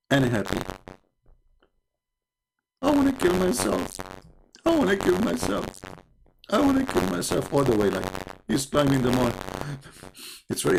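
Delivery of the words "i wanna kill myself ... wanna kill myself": high whiny hammed-up despair; mock despairing prophet. Prophet climbing the mountain, mock whiny despair. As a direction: whine it like a cartoon sulk.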